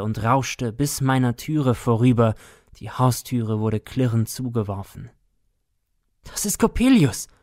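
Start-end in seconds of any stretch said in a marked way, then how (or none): 6.2-7.3 high, louder cry of terror; horrified cry. A narrator's reading. Boy at midnight crash, horrified high cry. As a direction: shriek it out in horror. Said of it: "rief ich entsetzt".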